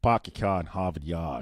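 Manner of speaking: copying a Boston accent, slowly; slow Boston accent, R's dropped. Shown as a demonstration. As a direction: imitate a non-rhotic Boston accent. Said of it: "they don't do the R's".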